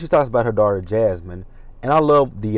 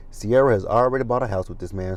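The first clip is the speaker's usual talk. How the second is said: usual speaking voice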